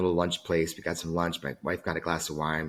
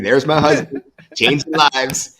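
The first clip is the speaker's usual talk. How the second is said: higher, loud imitation of a cheering wife; mock proud call; 6 semitones higher, 12 dB louder, slower than usual. Wife waves at her husband hugging a stranger, playful loud call. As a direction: call it out big, stretching the last words.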